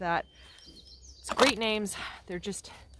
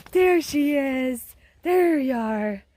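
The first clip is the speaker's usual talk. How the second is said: loud, high praise for the dog, slow at first; loud sing-song praise to her dog on a walk; happy calling out to dog; 5 semitones higher, 10 dB louder, slower than usual. Real, not acted. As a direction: cheer the dog on from afar.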